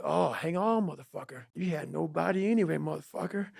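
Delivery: low, hushed self-talk; muttered inner pep talk; self-talk under the breath. Shown as a demonstration. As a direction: growl it quietly to yourself. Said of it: "see how I'm talking myself right now".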